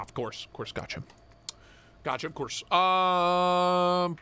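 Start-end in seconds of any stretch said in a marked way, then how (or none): 0.0-2.6 breathy whisper to himself; hushed mock understanding; whispered aside. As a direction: mutter it under your breath, amused. Reading chat, mock agreement.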